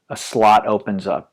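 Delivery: ordinary talk, nothing marked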